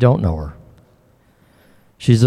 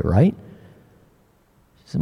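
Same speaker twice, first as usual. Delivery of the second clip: usual speaking voice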